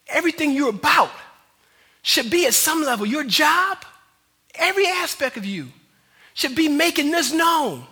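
fervent sermon crescendo; impassioned preaching. Real, not acted. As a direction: drive it home to the congregation, loud. Preaches at full force.

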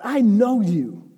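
slow "I know you", stressed on I; God speaking emphatically. Acted. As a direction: lean on "I", then stretch "know you". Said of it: "things that God is trying to say".

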